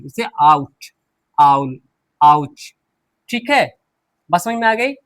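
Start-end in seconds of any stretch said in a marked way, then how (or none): 0.4-2.7 आउट, आउल, आउच low, spaced, opening on "ow"; "au" pronunciation. Shown as a demonstration. Teacher models ou words, आउट, आउल, आउच with "au". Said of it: "उसको हम आउ पढ़ते हैं".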